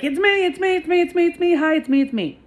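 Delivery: high, eager it's me, it's me; bouncing up and down calling out; excited waving call. Retold or imitated. To the arriving driver, an eager high call. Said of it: "and I'm waving, like".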